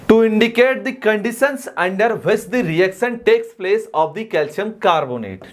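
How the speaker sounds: very slow, word-by-word dictation of a definition. Shown as a demonstration. Teacher dictating a definition to students, very slowly. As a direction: read it out slowly so the class can write it down.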